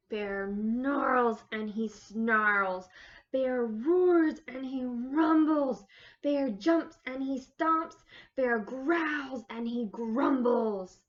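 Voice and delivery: loud growly bear storytelling; roaring narration. A narrator's reading. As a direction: boom it out like an angry bear.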